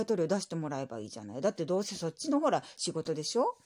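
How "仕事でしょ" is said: normal talking voice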